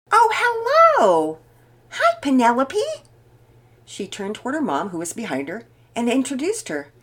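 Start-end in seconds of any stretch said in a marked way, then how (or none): 0.1-2.8 high, drawn-out delighted hello; pleasantly surprised. A narrator's reading. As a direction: greet an unexpected friend loudly and happily. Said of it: "looked pleasantly surprised".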